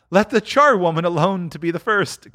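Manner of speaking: put-on high, eager old woman's cry; a sly charwoman getting in first. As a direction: call out, greedy and gleeful. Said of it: "cried she who had entered first".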